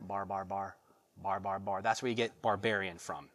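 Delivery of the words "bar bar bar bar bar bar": low, soft mock babble of foreign speech; imitating foreign babble. Preacher mimicking foreign speech as Greeks heard it, low babble. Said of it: "they sounded like this".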